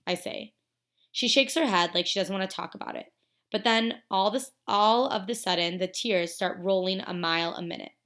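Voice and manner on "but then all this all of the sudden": plain reading voice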